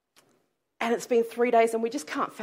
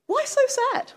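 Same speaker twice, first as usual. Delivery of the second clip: bright high friendly question, much higher pitch; stranger's cheery greeting; 12 semitones higher than usual. Retold or imitated. Stranger greeting the grieving disciples, high and breezy. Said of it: "and says".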